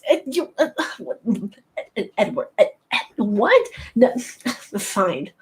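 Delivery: breathy, broken mock stammer; Bella. Imitating a Twilight heroine answering questions, flustered stammering parody. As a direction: stutter and restart, never finishing the thought. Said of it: "she would just be like".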